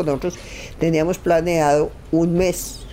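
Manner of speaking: usual speaking voice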